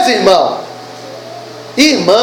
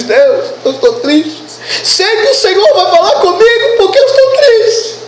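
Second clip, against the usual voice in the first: higher, lightly hammed-up self-pity; mock sad worshipper; 7 semitones higher than usual. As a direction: imitate a believer begging for attention. Mimics a sad believer in church.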